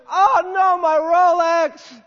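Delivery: very high, loud, slow cry over the watch; anguished wail. Acted. Driver noticing his lost arm, wailing over his Rolex. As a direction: wail it like the watch matters most.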